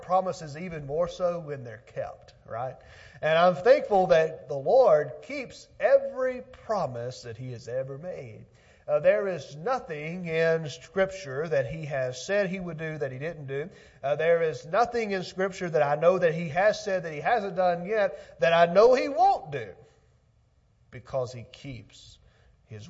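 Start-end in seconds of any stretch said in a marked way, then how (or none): 3.2-7.2 loud, high, driving pulpit delivery; forceful preaching. Acted. 8.9-19.7 raised, louder preaching voice. Acted. Preaching at full force.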